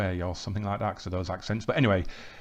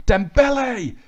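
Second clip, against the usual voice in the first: sudden high, loud cry of the name; excited shout; 13 semitones higher, 9 dB louder, slower than usual. Real, not acted. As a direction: yell the name as the chance opens. Goal chance in match commentary.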